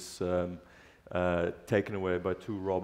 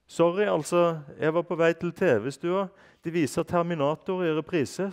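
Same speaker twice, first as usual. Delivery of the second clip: higher, louder apologetic robot voice; apologetic vacuum cleaner; 9 semitones higher, 7 dB louder than usual. Acted. Vacuum cleaner apologizes to the butler, higher robot voice. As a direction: excuse yourself in a hurry. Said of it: "sa støvsugeren unnskyldende".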